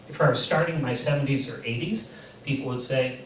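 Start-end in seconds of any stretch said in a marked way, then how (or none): none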